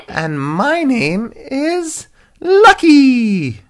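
slow, very high, loud happy hippo; Lucky. Acted. Happy hippo giving its name, squeaky proud voice. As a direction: announce the name with a long gleeful squeal. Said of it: "a happy hippopotamus".

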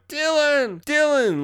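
high-pitched mock scream for someone lost; imitated screaming. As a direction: call out in a high, drawn-out imitation wail. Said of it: "she's been screaming for hours".

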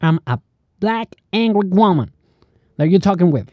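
sass it out like an irritated woman; angry woman. Retold or imitated. Retelling what a lady told him, mock angry woman. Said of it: "una señora que me dijo".

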